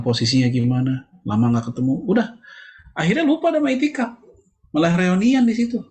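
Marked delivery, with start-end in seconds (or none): 2.0-5.8 exasperated exclaim. Acted. Preacher scolding worshippers who turn itikaf into a reunion, high exasperated exclaim. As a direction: voice leaps much higher in mock dismay.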